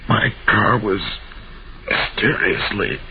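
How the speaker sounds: halting deathbed explanation, broken by long pauses; dying. Acted. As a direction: labor for breath every few words. Dying man explains, gasping.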